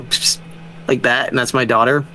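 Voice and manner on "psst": short unvoiced psst; sharp little signal noise; attention-getting psst. Shown as a demonstration. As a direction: hiss a quick psst to get a child's attention. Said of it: "Like, I go".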